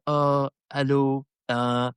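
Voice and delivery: slow, halting French mother; French mum. Retold or imitated. French mum introducing her children to a stranger, slow imitation. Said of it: "their mum came by and she said".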